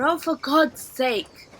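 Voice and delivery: loud, slow, fed-up sigh of words; exasperated Leela. A narrator's reading. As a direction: drag it out, exasperated. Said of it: "she said".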